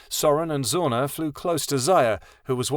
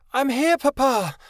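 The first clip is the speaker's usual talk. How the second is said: much higher, a small dragon's shout; child shouting; 13 semitones higher than usual. A narrator's reading. Hatchling shouting back to her father, high cry. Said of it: "Mirabel shouted".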